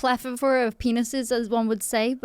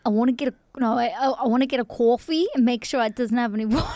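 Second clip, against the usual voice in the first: New York accent; 2 semitones higher than usual. Shown as a demonstration. British woman trying a coffee line for partner, shaky New York accent. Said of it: "Let me hear your New York accent".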